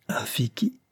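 a soft, stunned whisper of surprise; astonished whisper. A narrator's reading. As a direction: whisper it in disbelief. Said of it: "répéta le capitaine en ouvrant de grands yeux". (chuchoté, stupéfait)

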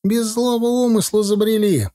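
high-pitched protest of innocence; acted pleading, pitched well up; frightened pleading. A narrator's reading. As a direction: beg off with a high, anxious voice. Titok kneels, begging the gunman.

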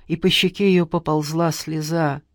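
ordinary delivery, nothing marked

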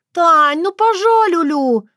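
put-on high, loud lisping baby voice; baby talk. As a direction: coo like a little child. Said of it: "засюсюкала она, подражая своему детскому говорку".

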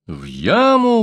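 high outraged echo; exclaiming. A narrator's reading. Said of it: "воскликнул Песотька".

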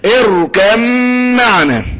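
slow اركب معنا, humming a doubled م; باء merged into ميم. Shown as a demonstration. Teacher repeats for students, باء merged into ميم. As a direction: join اركب معنا through a held م, dropping the ب. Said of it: "الباء ما تجيبلاش اي سيرة".

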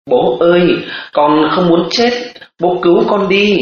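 put-on child crying. A narrator's reading. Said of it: "Hai cha con cùng bật khóc".

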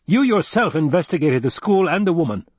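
high, insistent accusation; indignant retort. A narrator's reading. Duke reminding adviser of his own investigation, indignant. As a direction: throw it back at him hotly.

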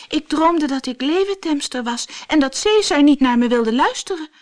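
put-on high, eager girl's voice; a lightly voiced girl, still excited; girl telling her dream. A narrator's reading. Floortje telling her father about the dream, high and eager. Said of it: "zei ze".

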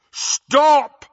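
loud booming command; thundering. A narrator's reading. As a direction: thunder it across the hall. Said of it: "Harry thundered".

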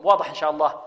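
normal talking voice

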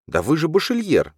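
high-pitched indignant exclamation. A narrator's reading. Alzhana protesting to Lali, indignant. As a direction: burst out in protest, pitch leaping. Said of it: "возмутилась Альжана".

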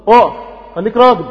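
loud, urgent exhortation; firm command. Acted. Preacher rallies believers in battle, firm command. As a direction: order them to stand their ground.

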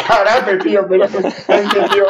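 high-pitched, words breaking into laughter; helpless real laughter through the words; laughing. Real, not acted. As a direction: crack up while repeating it in disbelief. Cracking up at karate question.

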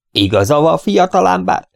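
put-on old man agreeing, rising high; a hint of high old-man voice; lightly voiced old man. A narrator's reading. (kissé öreges, felcsúszó hangon)

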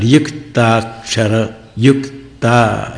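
युक्ताक्षर drawn out slowly, each part separate; clear conjunct. Shown as a demonstration. Teacher showing pupils how conjuncts sound, युक्ताक्षर slow and clear. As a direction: sound out the क्त in युक्ताक्षर distinctly. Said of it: "स्पष्ट उच्चारण हो".

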